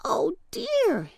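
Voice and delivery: high, worried storybook exclamation; small high voice of mild dismay; pumpkin crying out. A narrator's reading. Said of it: "he cried".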